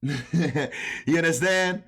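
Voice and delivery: a laugh breaking into the question, drawn out slowly. Real, not acted. Trading coach laughing as he asks viewers if they understand. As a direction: chuckle through it as you ask.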